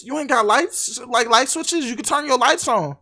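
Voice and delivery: loud, exasperated disbelief; exasperated scolding. Real, not acted. Yelling at game character.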